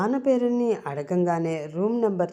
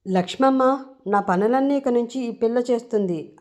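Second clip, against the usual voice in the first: higher and louder, calling out so the maid hears in the kitchen; 5 semitones higher, 4 dB louder than usual. Acted. Srinu calls loudly to Lakshmamma in the kitchen, loud call. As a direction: call it out loud toward the other room. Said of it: "అని గట్టిగా కిచెన్లో ఉన్న లక్ష్మమ్మకి వినిపించేలా".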